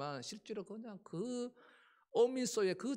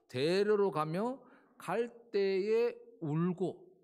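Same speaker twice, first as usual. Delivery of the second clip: very slow, loud, weighing every word; 대로로 가며 갈 때에 울고 drawn out loud and slow; emphatic reading; 8 dB louder, much slower than usual. Shown as a demonstration. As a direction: read it out slowly, leaning on 울고. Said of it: "우는 거죠".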